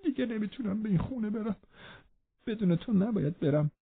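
high, soft, feeble plea; frightened pleading. A narrator's reading. Linton clings to Catherine for help, pleading in fear. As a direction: plead weakly in a small high voice.

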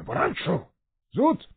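sudden sharp command; anger breaking out. A narrator's reading. Father orders his son to stand, anger breaking through. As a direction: let the held anger burst, pitch leaping on the last word. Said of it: "با غیظ و عصبانیتی که سعی داشت بروز ندهد".

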